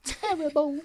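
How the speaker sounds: very high shocked queen; old queen voice. Pretend. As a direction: gasp it like a prim old queen. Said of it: "the queen's like".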